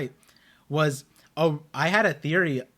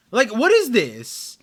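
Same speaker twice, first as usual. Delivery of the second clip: slow, louder exasperation; exasperated; 7 semitones higher, 7 dB louder, slower than usual. Real, not acted. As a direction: drag out the frustration. Frustrated at stretched episode.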